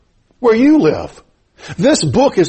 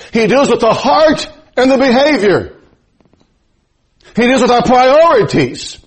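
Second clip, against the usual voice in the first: full-force preaching; 8 semitones higher than usual. Acted. Pastor urges his flock to put God first, preaching hard and high.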